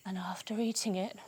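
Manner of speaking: hushed whisper. A narrator's reading. Teacher to kids, building suspense, whisper. Story turns to tummy ache.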